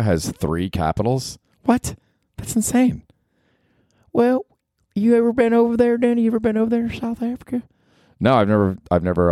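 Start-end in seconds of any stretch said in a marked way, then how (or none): 1.6-3.0 put-on high shocked old granny. Granny hearing the South Africa fact, high and shocked. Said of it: "Grandma, you've gotta stop talking". 4.1-6.2 granny voice. Acted. Granny flirting with Danny, high and coy. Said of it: "Grandma, you've gotta stop talking". 6.2-7.6 high, fast flirty granny. Acted. Granny pressing Danny about South Africa, high and fast. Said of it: "Grandma, you've gotta stop talking".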